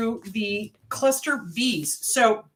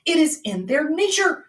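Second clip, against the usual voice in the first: ordinary delivery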